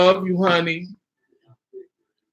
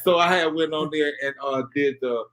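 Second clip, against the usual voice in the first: ordinary talk, nothing marked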